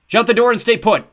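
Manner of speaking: loud holler, high and urgent. A narrator's reading. Dale hollering at the kids from downstairs, full shout. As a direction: bellow it through the door. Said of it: "Dale hollered".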